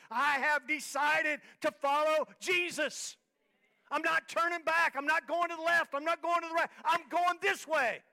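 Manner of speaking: high, resolute preacher's declaration; full-force preaching. Real, not acted.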